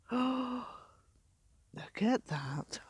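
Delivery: slow, breathy gasp then whisper; awed whisper. Real, not acted. Beachcomber marvelling at the marble, slow awed whisper. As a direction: hush it out in wonder.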